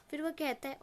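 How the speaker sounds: ordinary delivery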